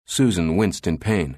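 usual speaking voice

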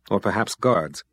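plain reading voice

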